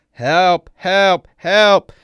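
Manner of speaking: high, very loud repeated screams; full-voiced cries for rescue; screaming for help. A narrator's reading. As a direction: scream it out in rising panic. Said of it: "continues screaming".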